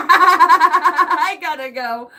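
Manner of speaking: barely gets it out between laughs; laughing helplessly, drawn out. Real, not acted. Laughing fit.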